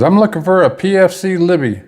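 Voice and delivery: high-pitched summons; retold calling out. Sergeant arriving to collect private, retold calling out. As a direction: call the name out as if searching.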